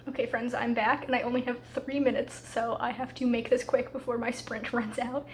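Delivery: ordinary talk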